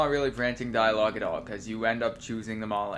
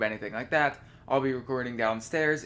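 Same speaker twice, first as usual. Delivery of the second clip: normal talking voice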